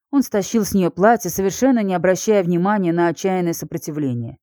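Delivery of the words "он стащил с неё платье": ordinary delivery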